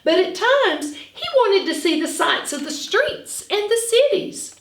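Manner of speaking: put-on lively read-aloud for young children; story narration. A narrator's reading. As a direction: read it like a picture book to a class. Teacher reads story aloud.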